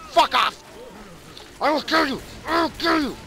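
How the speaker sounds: shouting furious threats; berserk rage. Real, not acted. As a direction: yell it in blind fury. Raging at zombies in game.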